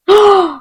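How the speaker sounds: a sharp, high gasp of delight; a real high gasp of wonder. Real, not acted. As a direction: gasp at something lovely. Opening a pretty product.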